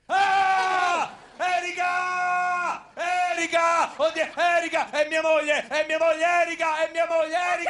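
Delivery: high, panicked cries for his wife; panicked shouting. Acted. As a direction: shout her name in alarm. Wife arrives, he panics loudly. (urla acute in preda al panico)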